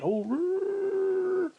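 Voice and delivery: high, squeaky mock growl; a silly high growl mimicking upset. Imitating Jean getting upset, a high mock growl. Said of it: "Like Jean would go".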